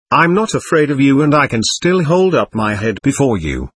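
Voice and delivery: plain reading voice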